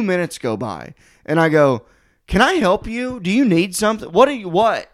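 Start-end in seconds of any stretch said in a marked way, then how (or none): 2.3-4.9 high, exasperated retold questioning; exasperated confrontation. Doorman asks lingering man what he wants, exasperated. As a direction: pitch it up, losing patience as you go.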